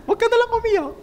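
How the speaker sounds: high-pitched imitation of empty comforting; mock consoling. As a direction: lift the voice high, playfully mimicking a consoler. Imitates people offering empty comfort.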